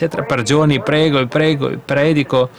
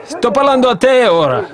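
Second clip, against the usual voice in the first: raised, higher cry to God; mock shout of prayer, higher; 9 semitones higher, slower than usual. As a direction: call out to God with hands raised. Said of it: "alza le mani in aria e urla".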